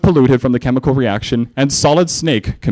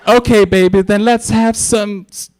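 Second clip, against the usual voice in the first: [name]; 9 semitones higher than usual. Acted. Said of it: "[name] came by and said".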